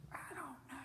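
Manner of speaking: hushed, breathy whisper; whispered I don't know. Retold or imitated. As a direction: whisper it back, caught out. Whispered reply to question.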